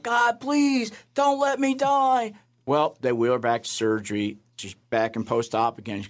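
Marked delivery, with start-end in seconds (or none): 0.0-2.3 high, slow pleading cry; a retold cry of desperate prayer; desperate plea. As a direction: beg God for your life. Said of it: "She cries out".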